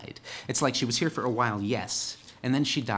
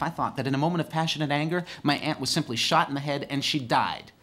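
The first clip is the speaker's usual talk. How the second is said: ordinary talk, nothing marked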